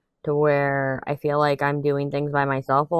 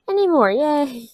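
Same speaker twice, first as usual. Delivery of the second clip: gleeful; 10 semitones higher than usual. Real, not acted. Celebrating coworker leaving.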